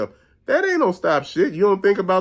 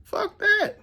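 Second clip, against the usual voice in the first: squeaky dismissal. Retold or imitated. Punchline to depressed friend.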